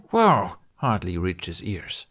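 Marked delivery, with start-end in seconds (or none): 0.1-0.6 high, loud yelp of alarm; startled cry. A narrator's reading. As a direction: cry out in sudden surprise. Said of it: "Hiccup's cry of".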